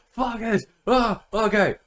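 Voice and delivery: high, loud exasperated ranting; retold anger. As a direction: act out getting angrier at the game. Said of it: "I was just getting really angry".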